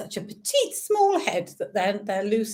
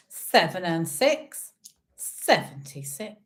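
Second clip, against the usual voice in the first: sing-song bingo number calls; bingo caller; slower than usual. Acted. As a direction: call each number out like a bingo hall. Online bingo calling numbers.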